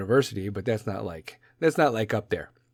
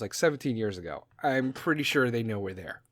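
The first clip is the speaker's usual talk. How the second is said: usual speaking voice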